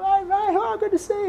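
very high, over-friendly hello; gushing greeting. Retold or imitated. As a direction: greet a mate in playful falsetto. Acting out greeting between mates.

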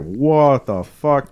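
slow, drawn-out quote; imitating a stunned, lingering question; retold stunned reaction. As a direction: stretch each word in mock astonishment. Acting out a chilling movie line.

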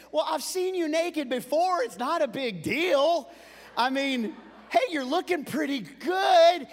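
high, loud, cheeky projection; loud goading son. Retold or imitated. Son getting under dad's skin, loud teasing. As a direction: call it out high so dad overhears.

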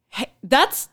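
high, loud half-laughing alarm; mock alarmed warning. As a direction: squeal the warning at him. Telling volunteer what he holds.

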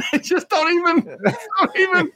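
helpless laughter through the words, pitch way up; laughing. Real, not acted. Host amused that films ignore the accent, laughing. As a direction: try to talk while cracking up. Cracking up at the third phase.

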